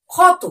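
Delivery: ক্ষত said loud and clear as "khot" with an aspirated kh; clear pronunciation demo. Shown as a demonstration. Teacher sounding out a word for children, loud and clear. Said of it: "ভালো করে শব্দটা উচ্চারণ করো".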